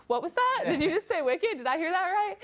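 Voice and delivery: high-pitched, playful disbelief; mock astonishment. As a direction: re-enact a stunned double take, voice leaping way up. Mock shock at roommates saying wicked.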